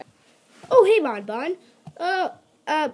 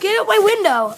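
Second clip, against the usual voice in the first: shouting; 11 semitones higher, 8 dB louder than usual. Acted. Character shouts.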